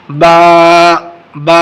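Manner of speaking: با stretched a little; drawn out. Shown as a demonstration. Quran teacher drawing out با for students. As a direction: stretch با slightly. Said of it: "تھوڑا سا کھینچ کر".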